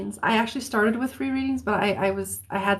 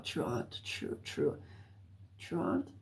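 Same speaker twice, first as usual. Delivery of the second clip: whisper; 6 semitones lower, 16 dB quieter than usual. Real, not acted. Musing over a puzzling message.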